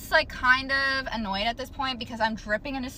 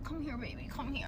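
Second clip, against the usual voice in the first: hushed imitation of a clingy boyfriend, softer; mock soft coaxing; 2 semitones higher, 12 dB quieter than usual. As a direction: murmur it like a pushy lover, mockingly. Retells boyfriend coaxing her.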